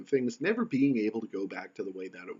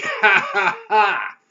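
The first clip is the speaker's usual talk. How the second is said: loud, high hammed-up villain laugh; mocking laugh; 10 semitones higher, 12 dB louder, slower than usual. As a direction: cackle in smug triumph, overdone. Mocking triumph in comic retelling.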